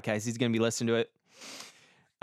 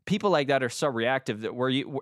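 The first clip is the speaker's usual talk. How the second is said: usual speaking voice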